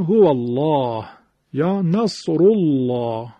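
الله in هو الله and نصر الله with thick L and drawn-out dark 'aa'; heavy lam. Shown as a demonstration. Teacher giving examples after fatha or damma, heavy lam. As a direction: make the L of الله big and full.

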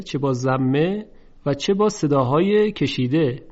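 usual speaking voice

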